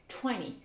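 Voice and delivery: American 'twenty' as 'twenny'; T dropped. Shown as a demonstration. Teacher repeats the rule, twenty without T. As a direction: let N replace T in "twenty". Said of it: "c'est le N qui prend le dessus".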